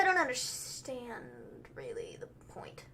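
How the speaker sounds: slow, dropping away into a whisper; puzzled slow whisper; hushed bafflement. Retold or imitated. Quotes own puzzlement, slowed right down.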